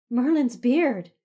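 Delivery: loud, slow startled cry; startled exclamation. A narrator's reading. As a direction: jump and blurt it out, slowly. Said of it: "she exclaimed".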